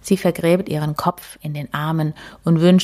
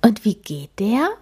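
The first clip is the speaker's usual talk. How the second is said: ordinary delivery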